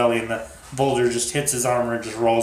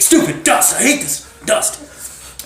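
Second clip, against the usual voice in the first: high-pitched mock fury at the dust; acted exasperation; 12 semitones higher, 3 dB louder, slower than usual. Facade with dust in his eye, exasperated cursing. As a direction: curse it in a hammed-up, strained high voice.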